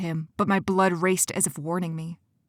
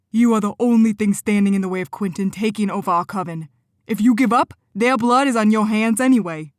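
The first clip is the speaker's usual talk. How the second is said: biting anger; 3 semitones higher, 6 dB louder than usual. Acted. Benny turns her words on Cora, harsh and loud. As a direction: bite back at her. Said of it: "the bite in his words".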